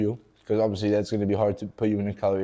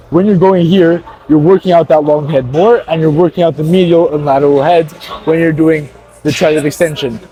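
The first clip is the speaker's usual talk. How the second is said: talking over music; 5 semitones higher, 12 dB louder than usual. Real, not acted. Coaching over loud gym music.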